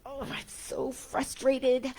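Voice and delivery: hammed-up moan of "frustrated"; mock frustration. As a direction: drag out a mock complaint. Mimicking someone's emotional complaint.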